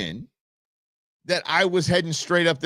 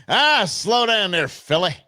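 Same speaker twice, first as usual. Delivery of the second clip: cowboy drawl; 5 semitones higher, slower than usual. Pretend. Jokey pet name, cowboy-ish.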